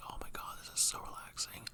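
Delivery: whispering. Retold or imitated. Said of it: "and I was just like".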